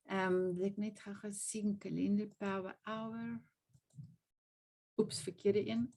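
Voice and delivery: talking to oneself. Real, not acted. Host hunting for the calendar on screen, murmured aside. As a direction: drop the volume, as if only for yourself.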